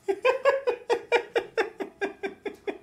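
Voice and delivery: high, helpless burst of laughter; frustrated laugh. Real, not acted. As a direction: laugh out of sheer exasperation. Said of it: "sinirden gülüyorum".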